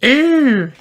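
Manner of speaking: put-on high toddler question; squeaky little brother asking; high piglet voice asking; George's voice. George reacts.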